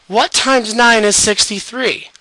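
ordinary talk, nothing marked

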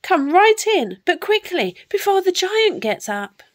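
high-pitched urgent welcome; high, lively giant lady; giantess. A narrator's reading. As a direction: usher the child in with hurried warning. Giantess urges Jack inside.

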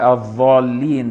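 الضالين slow with ظ for ض; dha for dad. Shown as a demonstration. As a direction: drag out الضالين with a ظ. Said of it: "بالضاء المشالة بدل الضاد".

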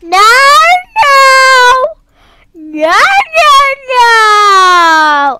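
loud crying "no, no, no"; panicked cry. Real, not acted. Playing the parkour map, crying out loud in panic. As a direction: yell it as you slip and fall.

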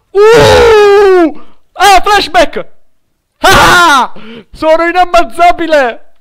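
loud, high shrieks of triumph; excited boastful yelling; triumphant shout. Real, not acted. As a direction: crow that nobody can kill you. Escaping death with flashback.

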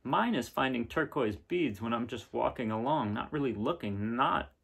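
gentle storybook reading, a bit brighter; reading to children. Acted. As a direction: read the picture book aloud to kids. Reading picture book aloud.

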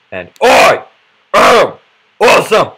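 loud, high triumphant shout; shouted catchphrase. Shown as a demonstration. Player finishing the catchphrase, shouting the last part. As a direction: shout each word as a big finish. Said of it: "You have to shout the last part".

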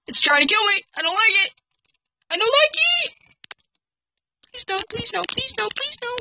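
high panicked pleading; anguished denial. Real, not acted. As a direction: beg in rising terror. Panicking as monster attacks.

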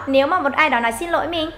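ordinary talk, nothing marked

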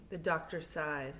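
usual speaking voice

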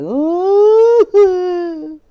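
high hammed-up wail; fake-sob like a baby; mock crying. Mocking the ex running off, hammed-up cry. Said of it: "It's like".

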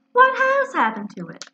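surprised. A narrator's reading. Eeyore startled about tail.

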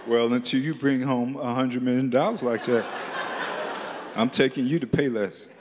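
low deadpan dad voice; gruff father. Retold or imitated. Cheap father refusing his son, low and dry. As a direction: shut the kid down like a cheap dad.

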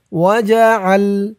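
وجعل- slow, with ع pressed hard from the throat; throaty ain. Shown as a demonstration. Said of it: "আইনের উচ্চারণটা গলার ভেতর থেকে শক্ত হয়ে". (وجعل-এ আইন গলার ভেতর থেকে শক্ত করে)

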